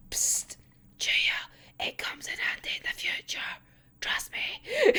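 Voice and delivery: soft secret whisper; a conspiratorial whisper to Chuya. Pretend. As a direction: lean in and whisper the tip.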